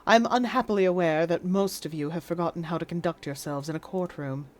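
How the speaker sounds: low, measured RP judge voice; weary British judge addressing the courtroom; stern English judge. Acted. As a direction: pronounce from the bench with dry disdain.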